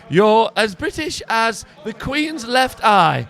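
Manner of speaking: slow, hammed-up British accent; a proud Englishman declaring loyalty; mock British accent. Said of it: "You are so British".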